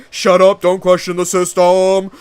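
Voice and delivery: loud barked order; loud mock command; mock shout. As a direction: yell it down like a bossy authority. Voicing authority shutting down.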